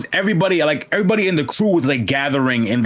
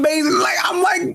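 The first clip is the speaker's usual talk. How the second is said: excited shout; 14 semitones higher than usual. Real, not acted. Hyped recounting the opening.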